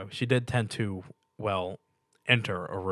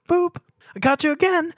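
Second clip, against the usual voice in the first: much higher and louder, gleeful squeal; playful squeal; 10 dB louder than usual. A narrator's reading. Pinkie pokes his nose playfully.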